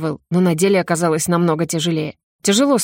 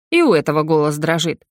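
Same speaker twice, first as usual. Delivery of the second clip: plain reading voice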